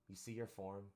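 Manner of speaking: normal talking voice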